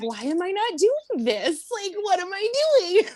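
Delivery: high-pitched retold exclamation ending in laughter; incredulous self-questioning. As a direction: question yourself in amused disbelief.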